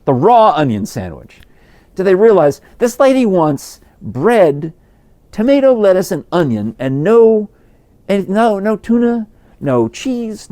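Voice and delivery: high, loud disbelief piling up each item; incredulous. Real, not acted. Storyteller marvels at wife's onion-only sandwich, incredulous.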